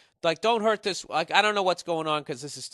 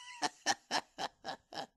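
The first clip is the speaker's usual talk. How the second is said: burst of laughter; 7 dB quieter than usual. Real, not acted. Laughing at his misreading.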